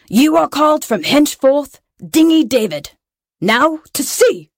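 high, put-on captain's proclamation; commanding pirate captain. A narrator's reading. Pirate captain renames the lad and orders departure, proclaiming.

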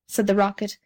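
ordinary delivery, nothing marked

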